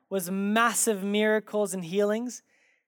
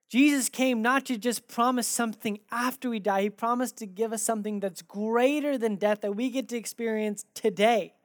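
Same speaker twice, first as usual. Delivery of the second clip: ordinary talk, nothing marked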